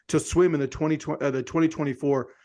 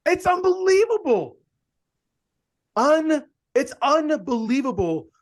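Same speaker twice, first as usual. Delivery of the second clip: amazed disbelief; 8 semitones higher, 4 dB louder than usual. Real, not acted. Host reacting to swimmer's modesty, amazed disbelief. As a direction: burst out of a laugh in astonishment.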